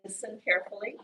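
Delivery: usual speaking voice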